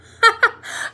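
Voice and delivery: high, loud mocking cackle; gleeful cackle. A narrator's reading. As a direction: cackle in triumph. Peeves laughs at Filch.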